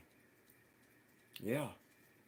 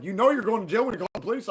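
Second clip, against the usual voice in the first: raised retold warning; 8 semitones higher, 6 dB louder than usual. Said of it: "They're like".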